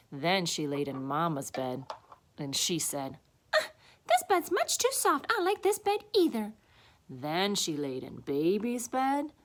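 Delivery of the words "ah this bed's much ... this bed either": put-on high little-girl complaint; fussy child voice, pitched way up; displeased Goldilocks. A narrator's reading. Goldilocks rejecting a chair or bed, high displeased voice.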